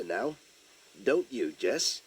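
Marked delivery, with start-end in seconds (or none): none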